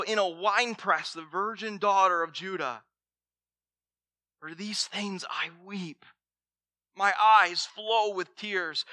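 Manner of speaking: scripture reading. Acted. Pastor reading Jeremiah's lament to church, solemn and aching. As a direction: read the lament as if it hurts.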